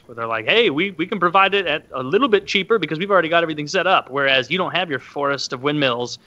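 ordinary talk, nothing marked

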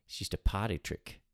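put-on Australian accent, mock-earnest; party-trick Australian accent, played straight. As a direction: explain yourself in a broad Australian accent. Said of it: "I'm well aware that I'm doing my Australian accent".

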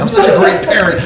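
very high, laughing self-congratulation; squeaky mock boast, laughing; mock proud parent. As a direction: gush with put-on parental pride. Mocks a self-satisfied parent.

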